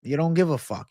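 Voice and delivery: ordinary talk, nothing marked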